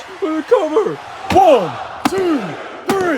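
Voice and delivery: rising high pinfall count; excited count. Acted.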